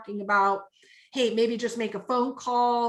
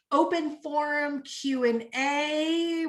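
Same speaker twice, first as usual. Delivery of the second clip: ordinary talk, nothing marked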